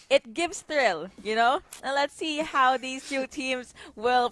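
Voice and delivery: it gives thrill, said loud and slow; excited. Real, not acted. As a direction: hype it up, loud and drawn out. Caster hypes the playstyle.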